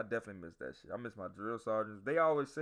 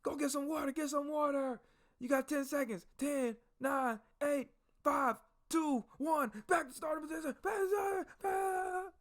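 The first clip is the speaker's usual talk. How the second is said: barked drill commands. Retold or imitated. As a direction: drill sergeant barking exercises at recruits. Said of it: "One drill sergeant was like".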